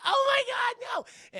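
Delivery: imitating a shrieking scream queen; panicked scream. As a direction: scream it like a horror victim. Woman screaming at gore.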